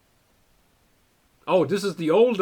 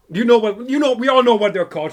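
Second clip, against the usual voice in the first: louder, exasperated burst; frustrated outburst; 8 semitones higher, 10 dB louder than usual. Real, not acted. Streamer straining to remember a snack name, exasperated. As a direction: blurt it out in real frustration.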